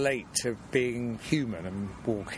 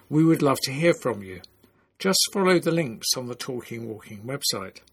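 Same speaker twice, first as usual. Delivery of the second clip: louder, measured presenter sign-off; podcast outro read; 3 semitones higher, 7 dB louder than usual. Acted. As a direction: read it out as a polished host signing off.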